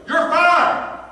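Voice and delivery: high, loud imitation of a boss shouting "You're fired!"; mimicking a furious boss yelling at a worker; mock angry boss. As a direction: bark the firing like a hot-headed boss. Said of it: "he'd get mad and go".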